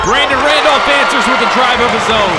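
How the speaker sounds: loud, high, energetic commentary; excited call. Real, not acted.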